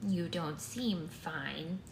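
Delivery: put-on slow, drawn-out old lady; grandma voice. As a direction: doubt them slowly, as a grandmother. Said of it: "this is just the regular grandma voice".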